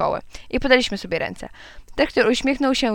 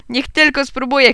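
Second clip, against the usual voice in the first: louder, higher schoolboy challenge; shouting; 5 semitones higher, 5 dB louder than usual. A narrator's reading. Boy defending his father to a rival, shouting. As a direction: throw it out as a loud dare. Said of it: "krzyknąłem".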